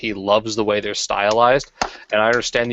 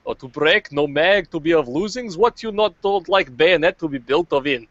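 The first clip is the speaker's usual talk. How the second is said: put-on Russian accent; 8 semitones higher than usual. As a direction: play a gruff Russian gun nut, broken English. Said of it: "let me get into the accent here".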